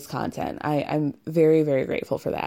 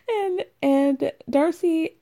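high, slow laughter spilling into the words; laughing; 10 semitones higher, slower than usual. Real, not acted. Host laughing to listeners at the awkward date. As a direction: lose it laughing.

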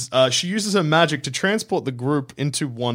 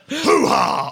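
loud, higher hoo-ha bark; Al Pacino; 11 semitones higher, 4 dB louder than usual. Pretend. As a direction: shout it like Pacino. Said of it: "I'm Al Pacino now".

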